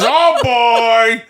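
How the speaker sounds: big drawn-out actor impression; De Niro doing Al Pacino. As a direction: belt out the catchphrase as a hammy impression. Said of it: "Al Pacino says".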